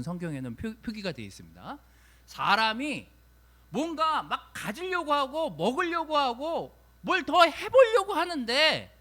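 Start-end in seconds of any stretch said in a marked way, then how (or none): 2.3-8.9 emphatic preaching. Acted. Preacher building on human striving.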